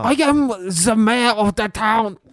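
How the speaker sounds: slow, high put-on Chinese accent; a pompous mayor in a fake Chinese accent; mock Chinese accent. Teacher joins drama game as mayor, mock Chinese accent. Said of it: "you can't do that accent".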